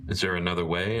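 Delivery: ordinary talk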